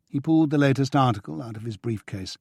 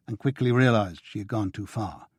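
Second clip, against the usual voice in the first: ordinary delivery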